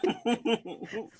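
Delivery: high, giggly protest; real laughter through the words; laughing. Real, not acted. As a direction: defend yourself while cracking up. Laughing defence of the crowbar.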